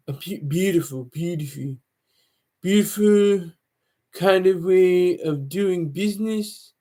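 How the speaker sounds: a lightly done, slow Donald Trump drawl on beautiful; half-joking bad Trump impression, dragging the words; terrible Trump. Vlogger mocking Trump on the future of Web three, lightly voiced.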